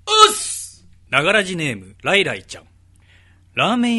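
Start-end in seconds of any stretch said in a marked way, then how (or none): 0.1-0.9 high, loud dojo-style oss; loud greeting. Acted. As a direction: bark out a pupil's greeting. Assistant's greeting, called out. (元気な掛け声)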